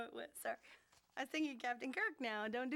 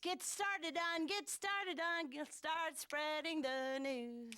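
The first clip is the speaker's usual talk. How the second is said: slow fumbling; much slower than usual. Acted. Fumbling the scripted line slowly.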